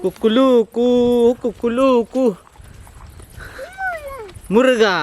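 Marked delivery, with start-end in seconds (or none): 0.0-2.3 high playful cock-a-doodle call; rooster crow. Pretend. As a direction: crow it out loud for the little girl. Imitates rooster for child. (बाळासाठी कोंबड्याची बांग)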